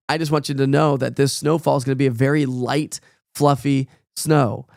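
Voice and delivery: ordinary talk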